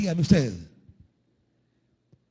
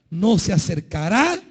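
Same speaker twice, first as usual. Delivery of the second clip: normal talking voice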